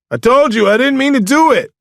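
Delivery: high, loud desperate yell; shouted protest. A narrator's reading. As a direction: shout back in self-defence. Arrested man protests.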